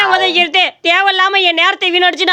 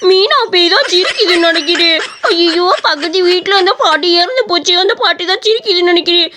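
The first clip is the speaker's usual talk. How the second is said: slow cartoonish wail, pitch leaping up; scared crying; 3 semitones higher than usual. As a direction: bawl in hammed-up terror of a ghost. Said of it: "எதுக்கு இப்படி வந்து அழுதுகிட்டு இருக்க". (பயத்தில் அழுது புலம்பும் குரல்)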